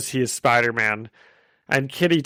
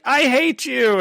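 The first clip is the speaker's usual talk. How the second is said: mock teen tantrum; 11 semitones higher than usual. Kitty storms off crying.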